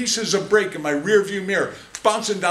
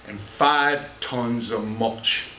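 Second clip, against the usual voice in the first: slow punchline; 7 semitones lower, much slower than usual. Acted.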